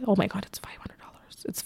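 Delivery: hushed whisper, as if a secret. Real, not acted. Whispering the real amount to her viewers.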